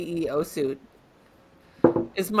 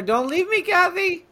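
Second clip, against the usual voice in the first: mock plea; 13 semitones higher, slower than usual. Her internet connection breaking up.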